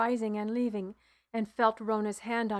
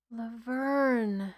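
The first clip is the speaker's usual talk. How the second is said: sighed. A narrator's reading. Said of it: "I sighed".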